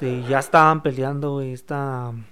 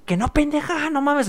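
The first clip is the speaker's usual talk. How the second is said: mock exasperation; 10 semitones higher than usual. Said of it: "en tu mente".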